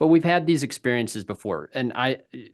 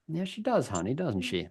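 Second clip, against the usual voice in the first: hushed parental reply; 3 semitones higher, 6 dB quieter than usual. Retold or imitated. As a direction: quietly agree with your child. Parent answering daughter in retold dinner.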